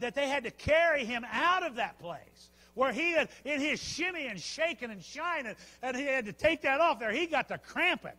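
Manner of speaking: high, energetic sermon storytelling; loud, driving preacher's anecdote; full-force preaching. Acted.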